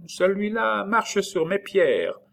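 put-on high, slow childish voice; child's voice. A narrator's reading. Child complaining about the stranger, high childish voice. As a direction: voice a child, pitched well up. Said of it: "La voix de l'enfant".

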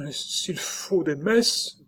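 high, slow, fearful offer; trembling voice. A narrator's reading. Frightened nurse addressing a ghost, trembling and high. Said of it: "d'une voix qui devenait tremblante".

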